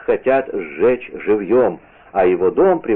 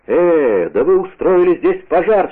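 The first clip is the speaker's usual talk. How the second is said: higher-pitched narrated outcry; indignant exclamation; 7 semitones higher, 4 dB louder than usual. A narrator's reading. D'Artagnan confronting the arsonists, indignant exclamation. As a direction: cry out at discovering a fire, slightly raised. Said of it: "вскричал он".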